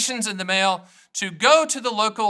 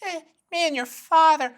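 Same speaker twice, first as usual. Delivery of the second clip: higher, put-on elderly mom; 9 semitones higher than usual. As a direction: quote his mom's catchphrase in her voice. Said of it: "my mom's voice from saying".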